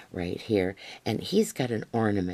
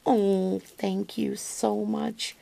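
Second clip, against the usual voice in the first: high, slow, gushing thank you; thrilled thanks to the friend for the bling; delighted thanks; 10 semitones higher, much slower than usual. Real, not acted. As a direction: pour out real gratitude, stretched and high. Finding bling in the gift.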